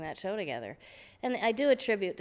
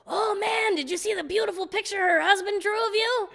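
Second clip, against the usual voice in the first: high, louder enthusiastic voice; gushing; 13 semitones higher, 7 dB louder than usual. Retold or imitated. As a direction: rave about the picture. Renato enthusing about the picture.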